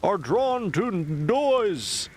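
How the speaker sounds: mock public-address warning, stretched and high; helicopter loudspeaker announcement. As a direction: call it out like a siren from the sky. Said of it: "the helicopters have been flying by saying".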